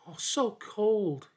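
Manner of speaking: high, slow lament; sorrowful. A narrator's reading. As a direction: mourn slowly over the cold body. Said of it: "he sorrowed".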